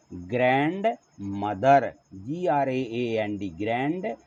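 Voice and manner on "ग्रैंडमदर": ग्रैंडमदर drawn out slowly and a bit louder; slow model pronunciation. Shown as a demonstration. Teacher answering her own question slowly with "ग्रैंडमदर". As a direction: stretch "ग्रैंडमदर" slowly for the class. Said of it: "English में क्या बोलते हैं?".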